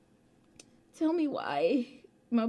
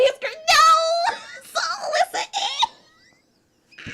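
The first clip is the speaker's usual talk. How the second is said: laughing; 6 dB louder, faster than usual. Real, not acted. Streamer laughing at egg.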